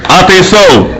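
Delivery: ordinary talk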